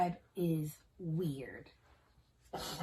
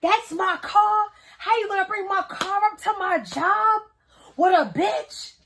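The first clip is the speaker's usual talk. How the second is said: higher, pleading then louder; a hint of the girlfriend's complaint, rising; lightly voiced girlfriend, building to outrage; upset girlfriend; 10 semitones higher, 5 dB louder than usual. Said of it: "She's like".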